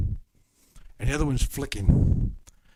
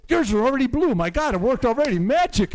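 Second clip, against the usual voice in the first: high, loud playful astonishment; hammed-up wonder at a miracle; mock amazement; 11 semitones higher, 5 dB louder than usual. Preacher jokes to a blue-eyed congregant, high mock amazement.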